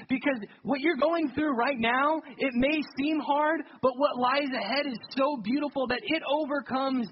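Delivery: preaching at full force. Acted. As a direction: lift the church toward hope. Preacher rises to climax.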